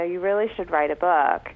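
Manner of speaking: ordinary talk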